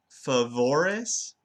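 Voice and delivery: "favores" slowly, English v and schwa vowels. Shown as a demonstration. Student demos her error, "favores" with an English v. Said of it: "that V sound from English".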